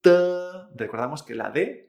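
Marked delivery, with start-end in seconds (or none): 0.0-0.1 "T" without air, followed by an e-o vowel; unaspirated T. Shown as a demonstration. Teacher models Chinese de, "T" unaspirated with E-O vowel. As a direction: say "T" as a puffless Chinese de. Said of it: "Es con T, pero sin aire".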